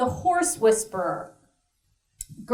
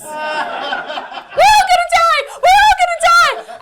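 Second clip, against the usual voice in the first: put-on high-pitched, full-force panicked child's shriek; daughter screaming; 9 dB louder than usual. Mother acts out daughter waking on the plane, screaming. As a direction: shriek it out like a terrified kid. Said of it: "she starts screaming".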